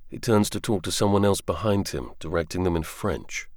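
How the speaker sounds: plain reading voice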